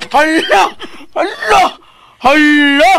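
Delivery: loud, high-pitched cries; alarmed exclamation. Acted. Helper reacting to his ma'am, loud alarm. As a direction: call each one out high, with a pause between.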